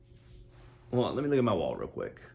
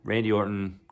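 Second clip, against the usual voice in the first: ordinary talk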